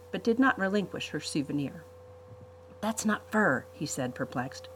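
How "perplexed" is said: usual speaking voice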